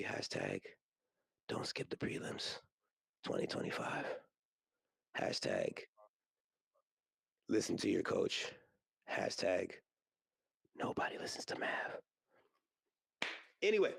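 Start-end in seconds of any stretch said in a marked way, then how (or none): 0.0-11.7 whispered aside, soft and low; mock whispered hashtags. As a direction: slip the hashtags in under your breath.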